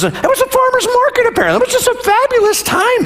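very high, fast, sarcastic enthusiasm; hammed-up rosy nostalgia; mock gushing. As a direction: gush as if fondly remembering a wonderful outing. Sarcastic retelling of rosy memories.

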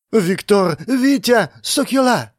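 put-on high, frightened young woman's voice; trembling panic. A narrator's reading. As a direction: cry out for him in alarm. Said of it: "Её голос дрожал".

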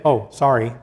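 tossing off "Oh, sorry." lightly, like it means nothing; a careless shrug of a sorry, lightly imitated; flippant mock apology. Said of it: "to say".

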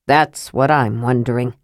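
low, grim dwarf voice; Flint, grim. A narrator's reading. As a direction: sound mildly grim, pitched well down. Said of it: "said Flint grimly".

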